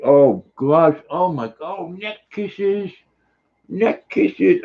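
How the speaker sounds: high-pitched cooing to a pet; high, gushing pet talk; doting on dog. Real, not acted. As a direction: melt with delight at dog kisses. Cooing over dog's neck kisses.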